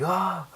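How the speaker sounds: high-pitched mock scream; freaked-out yelp. Eiji freaking out at Ankh, high mock yelp. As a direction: act out a panicked little shriek. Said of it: "Eiji freaks out".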